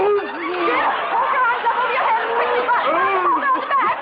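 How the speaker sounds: urgent, save him now; alarmed urging. Acted. Mother urges son to help choking father, alarmed.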